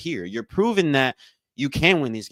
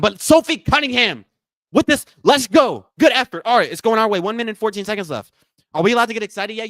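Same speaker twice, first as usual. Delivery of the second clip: high, loud, hyped game call; excited play-by-play; 11 semitones higher, 5 dB louder than usual. Real, not acted. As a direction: ride the rush of the comeback. Calls a big play excitedly.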